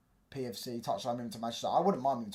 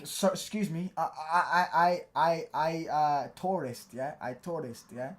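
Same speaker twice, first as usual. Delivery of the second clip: put-on stammering broken-English tourist accent, lightly done; halting foreign accent, fumbling; mock foreign tourist accent; 4 semitones higher, 6 dB louder, slower than usual. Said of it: "do a different accent".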